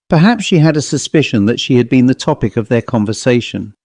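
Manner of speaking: usual speaking voice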